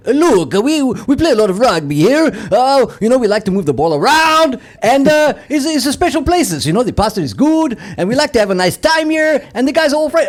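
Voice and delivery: lightly hammed Italian rugby player's accent; mock Italian accent. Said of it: "He's had such a thick, uh, Italian accent".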